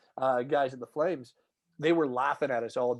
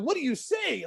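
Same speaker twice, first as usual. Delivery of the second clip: baffled retort; 11 semitones higher than usual. Retold or imitated. Stunned by the caller.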